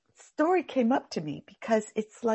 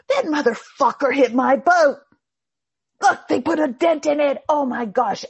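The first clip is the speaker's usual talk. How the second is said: higher, louder outraged rant; mock angry rant; 6 semitones higher, 6 dB louder than usual. Rule-giver rants at whoever hit his boat, outraged. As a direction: fume about the dented boat. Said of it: "they're the one going".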